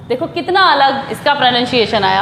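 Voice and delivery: usual speaking voice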